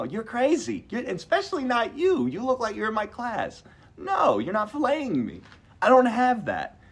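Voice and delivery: high-pitched, incredulous protest; indignant refusal. Retold or imitated. Patient refusing young surgeon, indignant. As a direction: act out refusing the surgeon outright.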